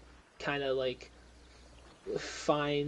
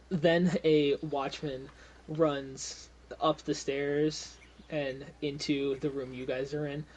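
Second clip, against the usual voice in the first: ordinary talk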